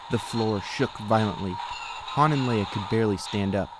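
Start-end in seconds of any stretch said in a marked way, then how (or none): none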